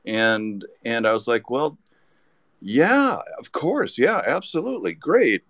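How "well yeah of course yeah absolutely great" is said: much higher, gushing yes; eager acceptance. Retold or imitated. Accepting the staff photographer job, giddy and much higher. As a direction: jump at the offer before they change their minds.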